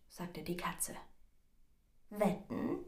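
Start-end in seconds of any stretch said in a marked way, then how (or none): 2.1-2.8 lightly daring mouse, rising question; challenging. A narrator's reading. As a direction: dare the cat with a touch of challenge. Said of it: "entgegnete die Maus herausfordernd".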